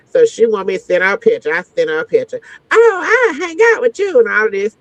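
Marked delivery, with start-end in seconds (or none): 2.7-4.2 high, girlish mock of an eager cousin; mock her eagerness; mimicked cousin.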